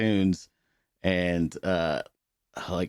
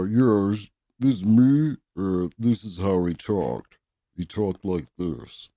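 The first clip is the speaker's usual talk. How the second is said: slow, put-on voice of a hated coworker, louder at first; mock the coworker's dopey drawn-out talk; impression of a coworker; 2 semitones higher, 5 dB louder, slower than usual. Mocking the coworker he could not stand, slow put-on voice. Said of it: "I used to do an impression of him".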